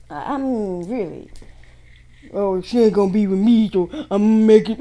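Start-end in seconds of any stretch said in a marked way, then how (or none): 2.3-4.8 loud mocking imitation of an ex; spiteful jealous ex. Reader voicing the jealous ex's scheme, loud and scornful. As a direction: mimic a bitter ex, loudly.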